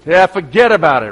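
light East Coast "fuhgeddaboudit" brush-off; East Coast accent. Retold or imitated. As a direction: toss it off like a New Yorker, East Coast accent. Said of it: "That's how they say it on the East Coast".

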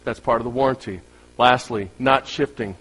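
ordinary talk, nothing marked

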